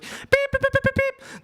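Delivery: high, loud imitated whistle blasts; squeaky beeping whistle impression; mock whistle beeps. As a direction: blow the whistle with your voice, shrill beeps. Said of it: "She's like".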